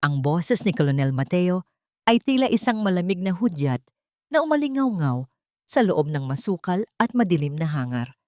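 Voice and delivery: usual speaking voice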